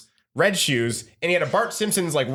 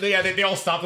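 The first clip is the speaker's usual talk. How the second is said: ordinary delivery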